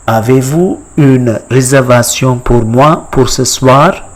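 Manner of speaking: "Avez-vous une réservation pour moi pour ce soir" joined up in French; French pronunciation. Shown as a demonstration. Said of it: "How do you say in French".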